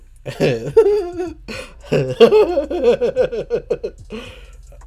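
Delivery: long high rising fit of laughter. Real, not acted. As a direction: laugh hard and keep going. Laughing at his own story.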